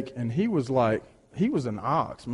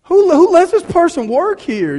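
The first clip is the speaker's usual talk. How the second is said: high, loud outraged cry; outraged parent; 13 semitones higher, 7 dB louder than usual. Retold or imitated. Parent outraged at the nurse's handling, high and loud. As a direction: blurt it in shocked outrage. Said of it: "you're like".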